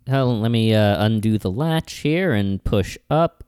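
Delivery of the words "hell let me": usual speaking voice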